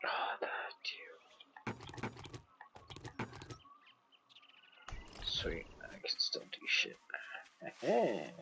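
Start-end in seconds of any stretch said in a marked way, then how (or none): 0.0-7.0 hushed whisper, much softer. Real, not acted. Gamer muttering to himself during play, whispered. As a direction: whisper under your breath while playing.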